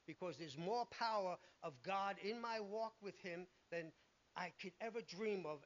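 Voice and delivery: ordinary delivery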